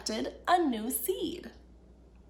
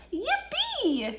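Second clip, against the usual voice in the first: high, gleeful cheer; gleeful yippee; 11 semitones higher than usual. Acted. Little fox racing through the woods, high gleeful cheer. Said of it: "he shouted with glee".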